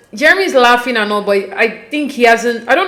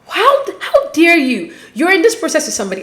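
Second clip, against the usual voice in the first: indignant scolding; 7 semitones higher than usual. Acted. Viewer scolding Jeremy for cheating on Laura, indignant. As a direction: sputter in disbelief, pitched high.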